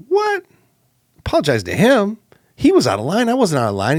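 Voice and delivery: high-pitched indignant disbelief; upset protest. Retold or imitated. Upset man arguing with God over apologizing, indignant. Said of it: "I was like, I was upset".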